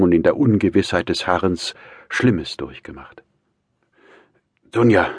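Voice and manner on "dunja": raised, effortful address; labouring to go on; laboured. A narrator's reading. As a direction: call her name with effort. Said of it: "fuhr Raskolnikow mühsam fort".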